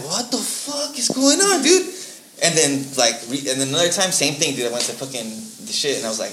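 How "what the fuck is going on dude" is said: slow, high, bewildered mock question. Everyone in the cafe staring at him, slow baffled. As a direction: drag it out, baffled.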